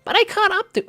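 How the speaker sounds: put-on very high falsetto girl voice; trial girl voice. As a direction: push it right up into falsetto. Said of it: "that could sound wrong".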